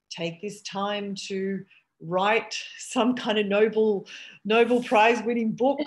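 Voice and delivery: loud, high mocking parody; slow, loud, poking fun at advice; mocking quote. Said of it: "we were joking as well".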